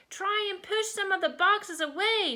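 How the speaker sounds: put-on high-pitched child's instruction; loud urging. A narrator's reading. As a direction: call it out high over the storm. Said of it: "Freddie said loudly".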